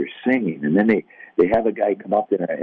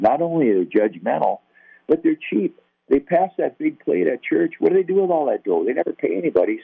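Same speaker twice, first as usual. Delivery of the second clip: mock rant; 10 semitones higher than usual. Ranting about Christians to teammate.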